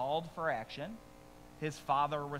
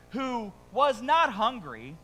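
"not" stretched high and loud, slow; mock stress on not; 7 semitones higher, 9 dB louder, slower than usual. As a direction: lean hard on "not", teasing. Mocking son's refusal.

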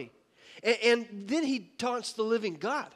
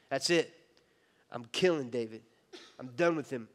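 much lower, cold king's resolve; menacing king; 8 semitones lower than usual. Acted. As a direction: voice Saul darkly deciding to kill. Jealous Saul decides murder.

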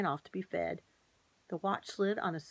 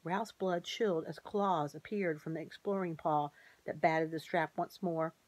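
ordinary delivery

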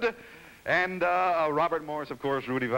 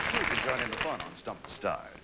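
normal talking voice

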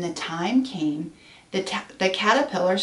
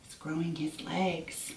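plain reading voice

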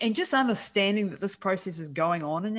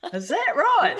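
cheeky challenge; 9 semitones higher, 6 dB louder, slower than usual. Retold or imitated. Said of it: "It's like".

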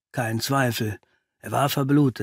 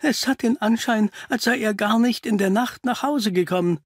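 high-pitched, worried woman's voice; nervous woman; 9 semitones higher than usual. Acted. Mrs Doyle fears Simon never came home, nervous. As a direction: pitch it up, fretful and uneasy. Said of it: "Die Frau fuhr sich nervös".

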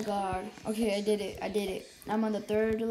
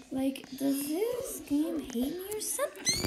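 frustrated whisper; 6 semitones higher than usual. Real, not acted.

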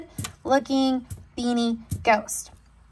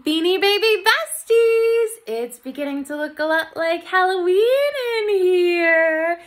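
excited; 9 semitones higher, 3 dB louder than usual. Real, not acted. Excited channel greeting.

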